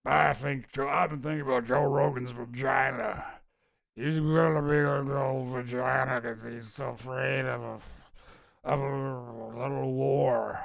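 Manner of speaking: put-on lightly done Texas accent, slow drunken slur near the end; a hint of a drunk Texan Alex Jones; Alex Jones impression. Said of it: "My Alex Jones impression is really just like a, uh, a Texas accent with, like, a gravelly voice".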